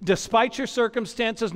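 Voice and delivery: ordinary talk, nothing marked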